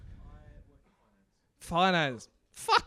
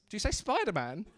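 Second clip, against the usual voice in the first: ordinary talk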